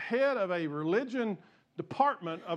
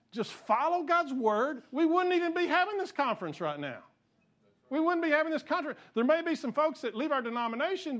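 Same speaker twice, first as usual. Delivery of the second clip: higher-pitched, worked-up preaching; fired up; 7 semitones higher than usual. Real, not acted. Preacher fired up at bishops over the conference, fired up. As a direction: let conviction push the pitch up. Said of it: "I get fired up".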